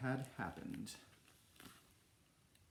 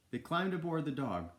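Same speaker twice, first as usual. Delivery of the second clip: usual speaking voice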